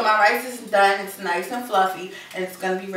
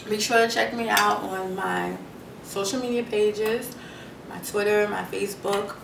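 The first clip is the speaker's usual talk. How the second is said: ordinary talk, nothing marked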